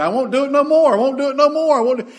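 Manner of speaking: put-on high, loud yelp of a child being whipped; pleading little boy. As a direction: imitate a kid frantically begging off a whipping. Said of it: "his dad would be, having him in a circle".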